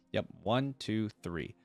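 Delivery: ordinary talk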